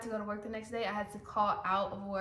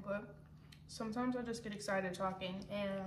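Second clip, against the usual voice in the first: ordinary delivery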